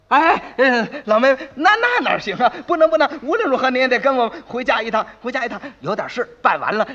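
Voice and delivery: fast, stumbling attempt to detain; flustered pleading. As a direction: scramble to stop a guest leaving. 慌忙挽留要回自己家的妹妹. (慌忙急促地挽留)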